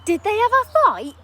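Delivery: put-on high, loud boy asking; boy hoping for a fight in the story; excited child. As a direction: ask with kid glee.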